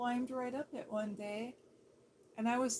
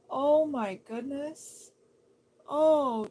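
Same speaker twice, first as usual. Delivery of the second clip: loud, slow, stunned oh my goodness; stunned cry of sympathy; shocked gasp; 3 semitones higher, 9 dB louder, much slower than usual. Real, not acted. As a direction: let the shock hit you. Shocked reaction to sad news.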